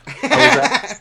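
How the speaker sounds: breaking into a laugh; laughing through the words. Real, not acted. Laughing start of story.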